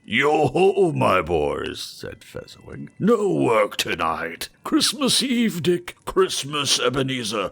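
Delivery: put-on rich, fat, jovial old master calling out loud. Fezziwig calls his apprentices, jovial and loud. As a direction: boom it out like a merry fat employer. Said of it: "jovial voice".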